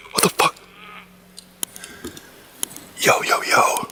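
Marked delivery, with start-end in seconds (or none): 3.0-3.9 scared whisper, breathy. Real, not acted. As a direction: hush it, alarmed. Alone, startled by noises.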